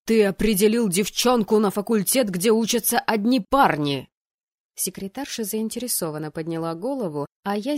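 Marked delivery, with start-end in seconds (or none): 0.0-4.1 loud, forceful protest; indignant raised voice; outraged dean. Acted. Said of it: "Голос декана Райана Валруа отчётливо доносился".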